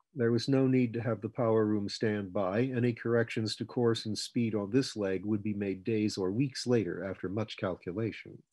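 ordinary delivery, nothing marked

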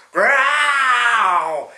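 loud, high final bear growl; big growl. Acted. Grandpa finishes the bears' growl with a loud shout.

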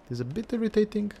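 high, quick restatement of a complaint; high-pitched repeat. Real, not acted.

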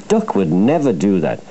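ordinary delivery, nothing marked

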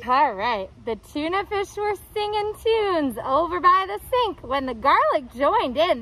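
usual speaking voice

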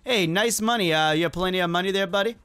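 usual speaking voice

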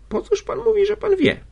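very high, loud reproachful cry; exclamation. A narrator's reading. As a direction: burst out at him, voice shooting up. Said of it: "zawołała". (wysoki okrzyk z wyrzutem)

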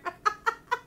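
high burst of laughter. Real, not acted. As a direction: laugh out, rising.

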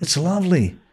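high, lightly cooed compliment; mock-admirer's lifted voice; admiring. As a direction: imitate someone charmed by an accent. Americans admiring his accent, retold.

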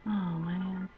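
ordinary talk, nothing marked

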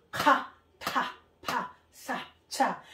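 Ka, ta, pa, sa, cha with a strong puff of breath on each; airy aspirated syllables. Shown as a demonstration. Teacher demonstrating aspirated Korean consonants, breathy bursts. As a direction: push air out on Ka, ta, pa, sa, cha. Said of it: "Parang may hangin".

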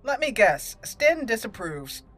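ordinary delivery

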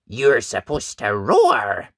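loud, slow telling-off; indignant prompt. A narrator's reading. Ned poking the silent dragon, loud indignant prompt. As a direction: stretch out the last word loudly. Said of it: "Ned said".

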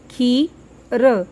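खी then र, each syllable said separately and held; sounding out syllables. Shown as a demonstration. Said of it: "देखिए". (अक्षर अलग-अलग करके धीरे बोलना)

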